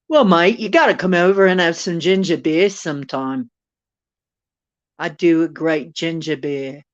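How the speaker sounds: put-on loud, teasing Aussie accent; hearty mock-Australian invite to a mate. Teasing Ken about ginger beer, Aussie accent. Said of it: "you like my Aussie accent?".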